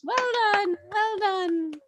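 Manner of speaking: high, put-on voice of praise; congratulating. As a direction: cheer them on in a lifted voice. Player congratulates in character.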